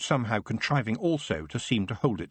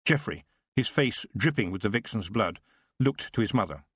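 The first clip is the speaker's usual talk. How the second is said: normal talking voice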